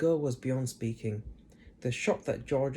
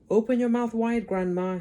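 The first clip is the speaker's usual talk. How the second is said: George coaxing; 9 semitones higher, 6 dB louder than usual. A narrator's reading. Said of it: "he said".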